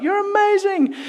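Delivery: high-pitched gushing crowd cheer; adoring crowd. Acted. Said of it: "everyone's like".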